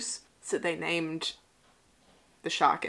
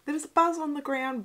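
high, loud, quick calling out; excited spotting; 8 semitones higher, 5 dB louder than usual. Real, not acted. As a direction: blurt out an exciting discovery. Spots an Easter egg, calls out.

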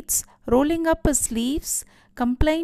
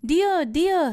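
narrated shout; 6 semitones higher than usual. A narrator's reading. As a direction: call out to your wife, mildly acted. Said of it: "he shouted".

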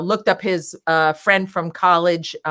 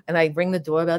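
normal talking voice

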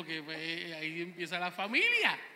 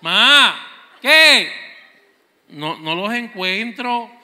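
calling out; 14 dB louder, much slower than usual. Retold or imitated. Hijo grita desde el cuarto.

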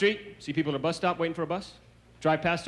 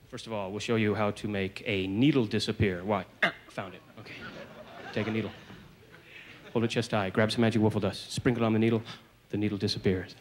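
lower-pitched magic show demonstration voice; mock magician; 5 semitones lower than usual. Magician showing the audience tricks, mock-mysterious low voice. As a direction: present a silly trick in a low voice.

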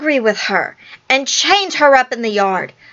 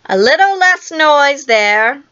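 slow, higher-pitched call for order; Peter calling out; 5 semitones higher, 4 dB louder than usual. A narrator's reading. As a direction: call across to noisy children, drawn out. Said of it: "Peter called out".

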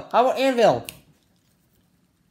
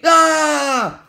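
loud, higher scream of frustration; frustrated scream; 6 semitones higher, 5 dB louder than usual. Pretend. Fed up with the stupid machine, frustrated scream. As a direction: yell out your exasperation.

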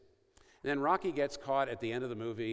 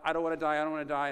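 louder, higher retold cry of terror; pleading cry, not a full scream; 5 semitones higher, 7 dB louder, much faster than usual. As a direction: voice a condemned man begging not to die. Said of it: "he starts crying out".

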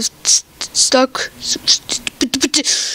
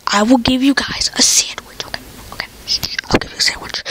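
whisper; 5 dB quieter, faster than usual. Pretend. Whispered sandwich promise.

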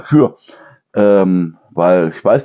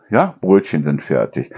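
usual speaking voice

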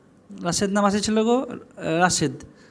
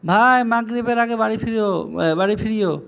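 near-usual pitch, conversational reminder; phone-call request. Shown as a demonstration. Asking someone on the phone to come home before Maghrib, conversational. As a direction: speak as if on the phone to a friend. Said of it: "কাউকে ফোনে কথা বলছেন তো বলেন".

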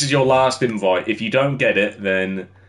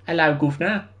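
mock British accent; 6 semitones higher, faster than usual. Mock British greeting to chat.

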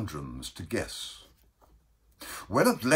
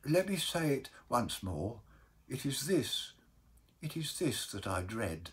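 soft, halting plea; dreading Baker; 3 semitones higher, 8 dB quieter than usual. Acted. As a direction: confess a fear quietly. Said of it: "and the baker replied".

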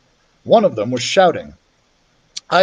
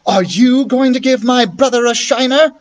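challenging sister; 8 semitones higher, 4 dB louder than usual. Acted. Beatrice challenges the other boy.

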